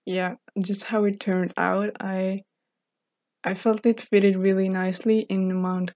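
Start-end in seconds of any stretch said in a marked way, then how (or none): none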